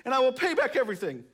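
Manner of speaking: lightly acted begging; mild pleading. A narrator's reading. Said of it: "he begged".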